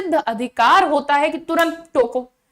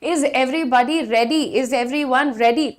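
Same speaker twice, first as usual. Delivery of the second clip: slow, clear classroom question; slow teaching drill; much slower than usual. Shown as a demonstration. Teacher asking class slowly if ready. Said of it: "हम आपसे पूछते हैं".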